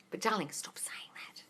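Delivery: slow, hushed reproach; whispered plea. Real, not acted. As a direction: whisper it to a client, drawing it out. Hushed follow-up to the scolding.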